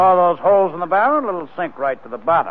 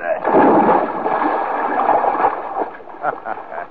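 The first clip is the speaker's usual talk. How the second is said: gloating laugh; 8 semitones lower than usual. Acted. Killer laughs as barrel sinks.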